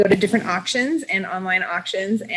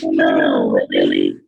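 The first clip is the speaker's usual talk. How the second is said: glitchy robotic connection voice; garbled, robotic bad mic; slower than usual. Real, not acted. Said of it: "No, you still sound like a robot".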